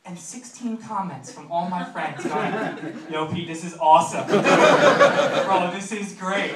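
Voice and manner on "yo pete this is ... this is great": loud, mock-excited shout; hyped bro. Friends commenting on his embarrassing video, loud and hyped. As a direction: crow it loudly like a delighted bro.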